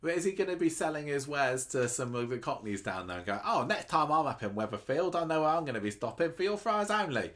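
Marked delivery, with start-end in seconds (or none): 3.4-7.3 a hint of Cockney, a bit higher; lightly put-on East London accent. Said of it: "my accent not as good as Bernie's was".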